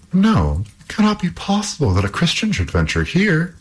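high, amazed princess cry. Acted. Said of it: "cried the princess".

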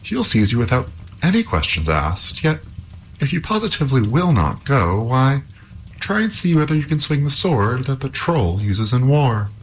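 high, worried princess. Acted. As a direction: warn him as an anxious princess. Princess continues warning.

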